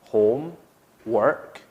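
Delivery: home and work said apart, each part stressed and rising; split into parts. Shown as a demonstration. Teacher showing students the two parts of a compound word. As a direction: pull homework apart into its two words. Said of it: "It's a compound word".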